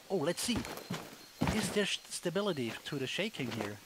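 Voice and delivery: usual speaking voice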